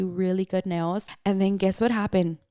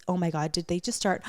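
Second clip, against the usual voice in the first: ordinary talk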